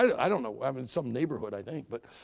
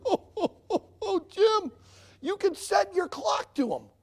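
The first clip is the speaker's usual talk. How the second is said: amused; 9 semitones higher, 4 dB louder than usual. Retold or imitated. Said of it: "And he starts laughing".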